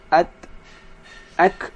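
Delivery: "At, ak" with only a slight hiss; light hiss at. Shown as a demonstration. Teacher showing a slight hiss on ta and kaf. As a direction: end "At" and "ak" with a faint hiss. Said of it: "sedikit aja desisnya".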